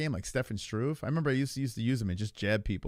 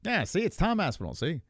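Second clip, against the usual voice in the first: high, swooping fifties broadcaster voice; Tom Aspinall; 5 semitones higher than usual. Acted. Streamer practising a fighter's voice, high old-time broadcaster impression. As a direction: ham up an old-time newsreel talker. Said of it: "Tom's got that w-, voice".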